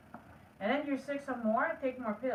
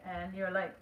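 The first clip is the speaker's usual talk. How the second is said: usual speaking voice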